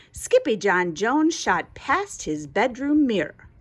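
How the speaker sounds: usual speaking voice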